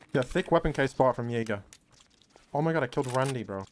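usual speaking voice

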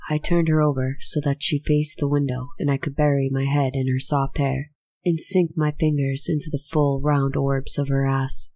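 plain reading voice

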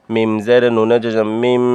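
মিম with a held final m; N read as M. Shown as a demonstration. Teacher spelling for learners, মিম closed on held m. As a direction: hum the closing m of মিম. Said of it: "নুনে জজমের জায়গাত মিমে জজমের উচ্চারণ হবে".

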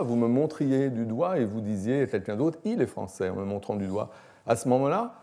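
ordinary talk

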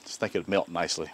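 ordinary delivery

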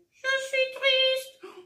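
high, slow sad puppet voice; the puppet admits it is sad, slowly. Acted. Marionnette triste. (voix de marionnette aiguë, lente et triste)

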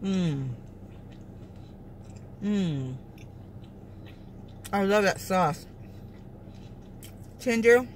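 talking between bites; chewing with lips closed; mouth full, chewing. Real, not acted. Seen 2: Bites at -3s; lips closed and chewing; food visible at 4.7s.

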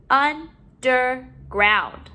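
"Underground" slowly, in separate syllables. Shown as a demonstration. Teacher modeling syllables to put together, broken up. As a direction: pause between each syllable of "Underground". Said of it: "let's put our syllables together".

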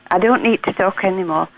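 ordinary delivery

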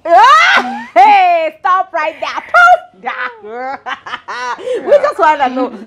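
high, loud playful squawk; mock outrage. Interviewer brushing off the guest's privacy plea, mock outrage. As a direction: shriek a pretend halt, half laughing.

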